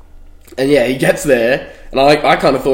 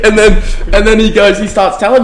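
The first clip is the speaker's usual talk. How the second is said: laughing; 7 semitones higher, 5 dB louder than usual. Real, not acted. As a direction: still laughing, higher pitch. Still laughing after quote.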